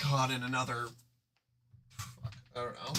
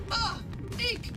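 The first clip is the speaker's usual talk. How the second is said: put-on child shriek.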